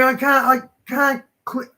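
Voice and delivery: ordinary talk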